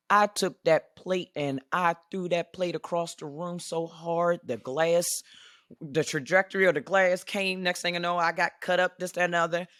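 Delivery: fired-up storytelling, pitch and volume climbing; heated retelling. Real, not acted. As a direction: relive the rage of throwing the plate. Telling how she threw plate.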